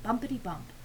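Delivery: ordinary delivery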